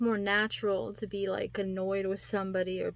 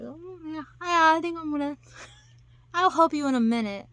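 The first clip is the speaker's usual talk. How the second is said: mock distracted man; 7 semitones higher, 7 dB louder than usual. Voicing the man in video.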